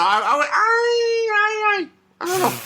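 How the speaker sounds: high, breaking fake cry; mock sobbing. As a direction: wail it like a pretend breakdown. Mock breakdown over the comic.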